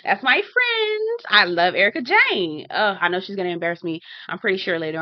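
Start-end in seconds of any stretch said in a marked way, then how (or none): 0.0-1.2 very high, slow, stretched squeal of delight; high-pitched squeal. Retold or imitated. Fan spotting [name] on screen, very high slow squeal. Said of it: "I got really excited seeing [name]". 1.3-2.7 squealing higher and higher, stretched out; a fan losing it as her favourite appears; excited fangirling. Retold or imitated. Fan greeting [name] on screen, climbing excited squeal. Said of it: "I got really excited seeing [name]".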